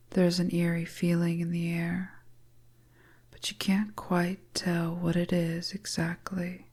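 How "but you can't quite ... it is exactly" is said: ordinary delivery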